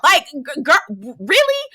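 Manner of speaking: slow, drawn-out disbelief; really? stretched in disbelief; incredulous. Real, not acted. Disbelieving reaction to maids.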